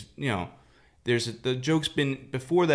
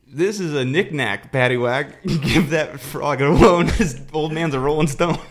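louder, laughing punchline delivery; punchline bank manager; 5 semitones higher, 8 dB louder than usual. Acted. Bank manager delivering the joke's punchline, laughing and loud. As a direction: belt out the punchline while cracking up. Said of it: "and he goes, uh".

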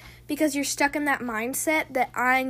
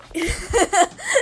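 surprised laugh; 6 semitones higher, 8 dB louder than usual. Real, not acted. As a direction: burst of startled laughter. Light suddenly turned off.

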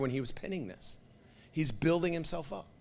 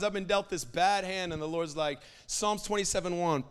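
normal talking voice

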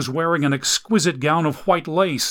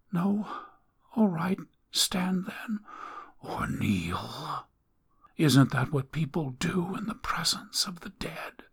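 higher, hushed, sneering whisper; mocking dying woman; 5 semitones higher, 7 dB quieter than usual. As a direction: taunt him about kneeling. Dying woman mocks him.

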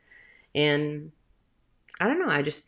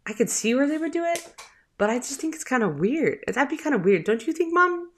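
high, quick imitation of a puzzled child; questioning son; 9 semitones higher than usual. Son testing his mom about Santa, high questioning child voice. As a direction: voice a kid probing his mom, pitched up. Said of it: "then he's like".

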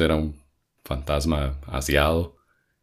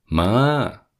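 higher, drawn-out mock call; calling for mom; 5 semitones higher than usual. Child calling his mother from elsewhere, imitated call. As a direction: call out to mom across the house. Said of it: "donde yo dije".

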